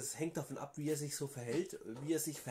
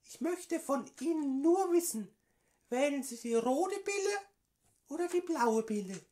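höhere, gespielte Politikerinnenstimme; Frauenstimme; 10 semitones higher, 10 dB louder than usual. Acted. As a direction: play a woman caller, higher pitched. Prank call as AfD woman. (verstellte Frauenstimme am Telefon)

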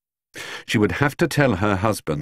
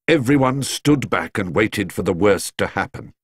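usual speaking voice

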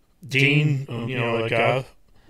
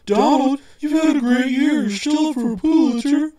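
much higher, louder, prim mock editor; 13 semitones higher, 4 dB louder than usual. Mimicking his editor telling him he lost the newsroom, high and loud. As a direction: ape the editor's scolding in a high voice. Editor voiced in retold meeting.

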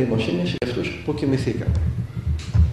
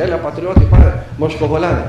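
loud, retold street seller's pitch; hawking fish; 8 dB louder than usual. Fisherman urges a passer-by to buy fresh fish, loud hawking. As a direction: call out your wares to a passer-by, loud. Fisherman touting his catch.

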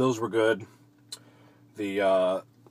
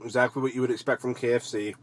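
talking while chewing food. Real, not acted. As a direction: mouth partly full. Seen 0: Bites nugget at -9; still chewing while speaking until about 2.8s.

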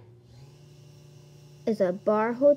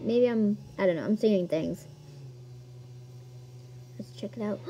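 ordinary talk

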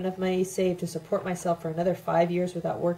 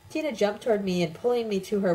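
usual speaking voice

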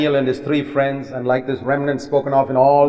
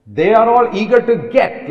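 higher and louder, indignant; indignant preaching; 6 semitones higher, 4 dB louder than usual. Real, not acted. Preacher to congregation, indignant and louder. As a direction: raise it up against greedy leaders. Preacher denouncing greedy leaders.

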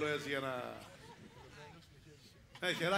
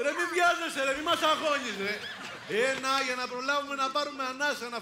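laughing tease; 8 semitones higher, 5 dB louder than usual. Real, not acted. Host teasing a rushed counter.

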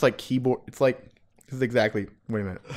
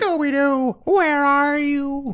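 high, slow, stretched Shaggy call; Shaggy impression; 14 semitones higher, 3 dB louder, slower than usual. Calling for Scooby in a high Shaggy voice. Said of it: "do a Shaggy impression".

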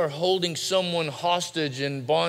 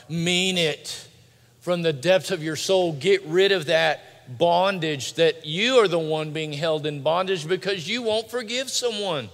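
raised, higher-pitched preacher's exhortation; forceful preaching; 5 semitones higher, 4 dB louder than usual. Real, not acted. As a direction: urge them hard from the pulpit.

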